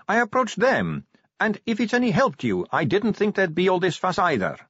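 high-pitched vampire recruit; Sally. A narrator's reading. Sally defends her application.

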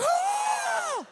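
high, loud, long airy shriek; imitated breathy scream of terror. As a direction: scream hard but let mostly air out. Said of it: "scream so loud, but it's just air".

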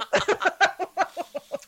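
high burst of laughter; laughing. Real, not acted. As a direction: laugh out loud. Laughing at the mishap.

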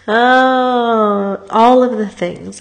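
loud tearful wail, voice breaking high; crying outburst. Real, not acted. To her viewers about grief, crying loudly. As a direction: let it burst out through tears.

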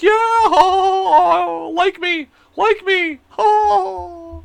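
very high, drawn-out mock laughing yeah; desperate fake laugh. People-pleaser begging "like me", desperate fake laughing. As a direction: squeal through a needy, put-on laugh. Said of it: "And you're just like".

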